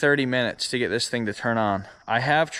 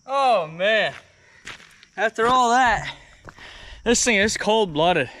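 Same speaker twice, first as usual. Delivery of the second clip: winded and panting, pitch up; gasping out words after kickstarting; out of breath; 9 semitones higher than usual. Real, not acted. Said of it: "I am dying".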